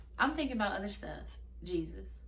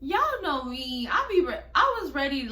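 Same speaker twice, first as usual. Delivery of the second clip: hyped up; 5 semitones higher, 7 dB louder than usual. Real, not acted. Hyped recounting of readiness.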